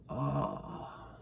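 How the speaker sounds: soft, breathed-out "ah"; quiet, contented exhale; relieved sigh. A narrator's reading.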